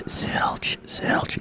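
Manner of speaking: soft breathy whisper. Retold or imitated. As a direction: whisper it like a secret. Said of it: "the last thing you hear is".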